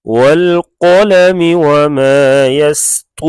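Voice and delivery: tajweed-style recitation; Quran recitation. Acted. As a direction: chant it with long held vowels. Recites verse after explanation.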